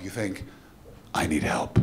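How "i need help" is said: desperate thought. Pretend. Onlooker's inner thought, unvoiced.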